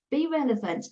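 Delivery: ordinary talk, nothing marked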